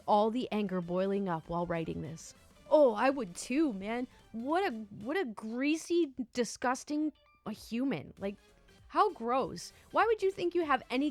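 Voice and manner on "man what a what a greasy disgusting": ordinary delivery, nothing marked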